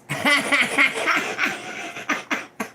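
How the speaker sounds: bursting laugh going way up high; real amused laugh. Real, not acted. Laughs after thanking the reader.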